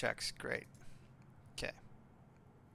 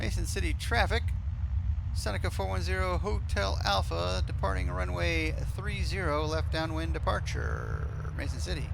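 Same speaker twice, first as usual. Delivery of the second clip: higher-pitched, procedural departure callout; pilot radio call; 6 semitones higher, 3 dB louder than usual. Real, not acted. Pilot to Mason City traffic, departing, higher radio-call voice. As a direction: announce the departure like a pilot on frequency.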